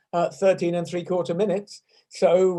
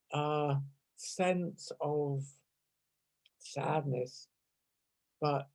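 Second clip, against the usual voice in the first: much softer, slow and calm; gentle reflection; 3 semitones lower, 8 dB quieter, much slower than usual. Real, not acted. Teacher reassures class that sadness passes, soft and slow. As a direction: ease them softly toward calm. Reflective reminder after exercise.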